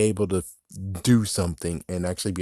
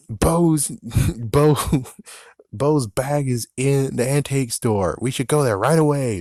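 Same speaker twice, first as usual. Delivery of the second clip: higher and louder, urgent and eager; urgent Woody; 4 semitones higher, 4 dB louder than usual. Retold or imitated. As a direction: rush to save her bag. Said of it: "and he's like".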